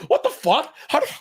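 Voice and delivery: the Predator reacting to Michelle Yeoh's flying leaps, laughing and high; baffled Predator. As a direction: squeal it like a bewildered alien hunter. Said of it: "And then Predator's like".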